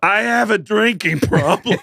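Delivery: high, laughing, slow quote. Retold or imitated. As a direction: laugh through the quoted line. Quoting someone, laughing.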